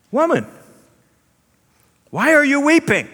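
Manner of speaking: high, loud gentle question from the tomb; angel voice. Acted. Angels at the tomb questioning weeping Mary, high and ringing. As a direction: call it out high like a heavenly messenger. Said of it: "angels at tomb ask Mary".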